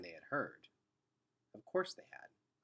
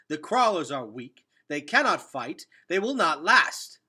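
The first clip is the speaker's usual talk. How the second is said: loud, higher, slowing on the last words; harsh scorn; 5 semitones higher, 13 dB louder than usual. Acted. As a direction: hammer home contempt, louder each line. Henry scorns weak crawlers.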